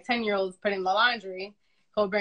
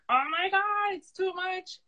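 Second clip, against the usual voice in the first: exasperated overwhelm; 10 semitones higher, slower than usual. Retold or imitated. Mother retells overwhelmed moment.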